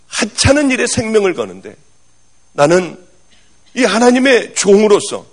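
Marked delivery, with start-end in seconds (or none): none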